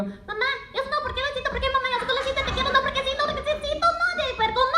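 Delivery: put-on high, childish voice; kids in Spanish. Relatives' kids whining at the comedian in a high childish voice. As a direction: whine like a little kid. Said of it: "they'd go".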